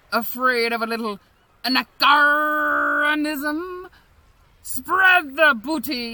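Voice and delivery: usual speaking voice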